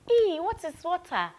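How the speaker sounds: high, wondering "Ee, what is water?"; imitating a puzzled child. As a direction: voice the kids' puzzled question. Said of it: "I know you are wondering".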